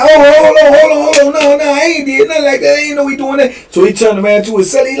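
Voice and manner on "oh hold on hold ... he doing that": much higher, fast panicky protest; flustered denial. Retold or imitated. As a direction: scramble to deny it. Inmate denying, flustered.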